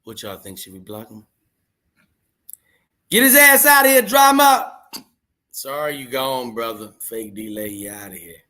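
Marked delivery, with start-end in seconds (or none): 3.1-4.7 much higher, louder call to ban him; loud order. Real, not acted. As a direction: shout the command at the moderator.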